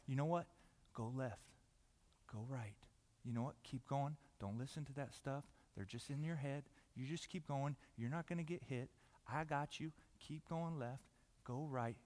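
low, very soft, calm guiding voice; hushed, reassuring coach; quiet guidance. Acted. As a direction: steer them gently under the noise. Calm guide against the yelling crowd.

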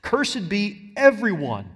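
Cursed slow and weighty; solemn stress on Cursed. Shown as a demonstration. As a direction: lean on the word Cursed, slowly. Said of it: "He seems to emphasize the earlier parts".

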